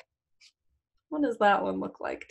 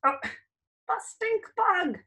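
mock surprise; 11 semitones higher, slower than usual. Teacher reacting to picture.

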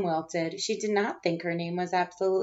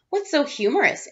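higher, louder teacher voice; 7 semitones higher, 6 dB louder than usual. Acted. Missus Twinkle asking the laughing class, higher louder. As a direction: ask the giggling class.

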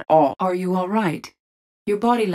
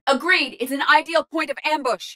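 high-pitched tactical agreement; urgent ambush warning; 10 semitones higher than usual. Acted. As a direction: alert the squad mid-mission.

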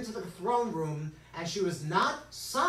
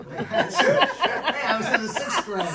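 laughing; 7 semitones higher, 11 dB louder, slower than usual. Real, not acted. Laughing at own childhood memory.

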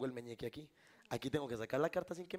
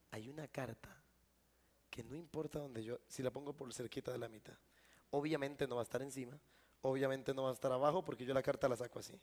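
ordinary delivery

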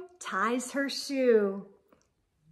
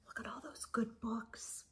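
a soft hushed whisper; whispered delight; 3 semitones lower, 15 dB quieter, faster than usual. Acted. A reader showing children the classroom books, whispering. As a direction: point at the picture in awe.

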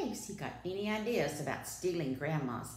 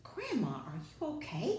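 put-on higher-pitched little girl's call; calling child; 5 semitones higher than usual. A narrator's reading. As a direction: call out to check on her, pitched up.

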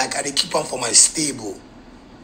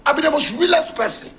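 high boast; 12 semitones higher than usual. Real, not acted. Boasting outburst.

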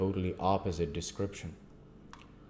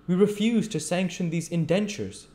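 higher, decisive judge voice; firm old magistrate; 11 semitones higher, 6 dB louder than usual. Acted. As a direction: deliver the ruling. Magistrate rules.

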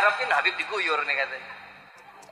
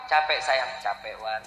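tired sigh; 8 semitones lower, 4 dB quieter, slower than usual. Acted. [name] voices his tired self to his wife.

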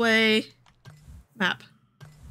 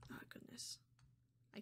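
whispering; 32 dB quieter than usual. Real, not acted. Nervous aside during stealth game.

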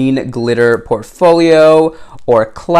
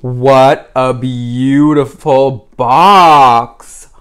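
ordinary talk

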